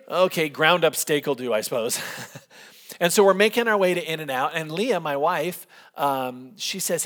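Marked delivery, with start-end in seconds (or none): none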